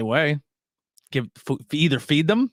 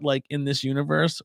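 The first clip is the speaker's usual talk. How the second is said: normal talking voice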